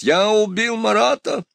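high-pitched, stretched-out shouted boast; shouting back. A narrator's reading. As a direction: cry it out in answer, very high. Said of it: "закричал в ответ Дантес". (протяжный высокий крик в ответ)

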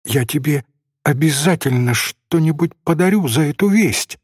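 plain reading voice

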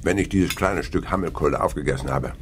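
ordinary talk

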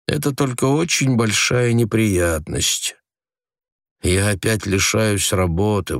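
calm reassuring. A narrator's reading. Husband comforting wife.

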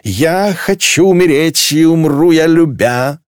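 high, slow, drawn-out wail; drunken wailing. A narrator's reading. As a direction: belt out a tipsy love lament. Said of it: "к стонам присоединилась Клелия".

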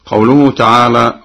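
ordinary talk

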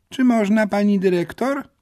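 a pupil pokes her head in; girl's voice. A narrator's reading. Girl peeks in. (dziewczynka, dużo wyżej)

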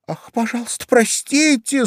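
high-pitched pleading exclamation; exclaimed apology. A narrator's reading. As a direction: cry out an apology in a girlish high voice. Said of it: "снова воскликнула Алиса". (высокий умоляющий возглас)

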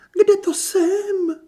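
very high, slow, drawn-out wail; theatrical fainting diva. A narrator's reading. Kristýna coming round from a feigned faint, wailing theatrically. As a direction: come round melodramatically, moaning high and loud. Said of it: "Kristýna zasténala a zavířila řasemi".

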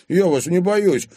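loud, high, bold retort; defiant. A narrator's reading. Boyfriend refuses to fear the father, defiantly. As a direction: stand up to him.

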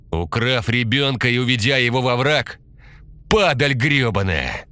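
spitting contempt; furious yell. A narrator's reading. Anton beating the maniac. (яростный крик)

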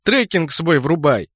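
angry order. Acted. Scylla continues snarling.

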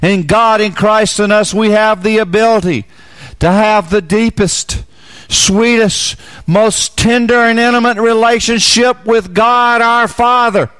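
high, loud fervent preaching voice; full-force preaching. Acted.

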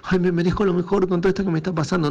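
high, rushed mock lament; needy, from lack. Showing listeners how not to ask, mock needy whine. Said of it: "no lo pidas de un lugar de carencia".

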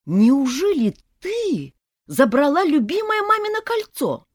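high, loud whiny reproach; fiancé siding with his mother; reproachful mama's boy. A narrator's reading. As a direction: scold her like a mama's boy. Fiancé scolds bride.

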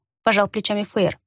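ordinary delivery

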